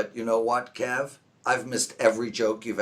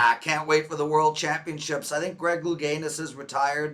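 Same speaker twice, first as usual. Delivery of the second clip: ordinary talk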